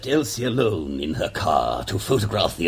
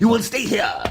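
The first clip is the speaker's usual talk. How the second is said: stern command; 12 semitones higher, 4 dB louder than usual. Acted.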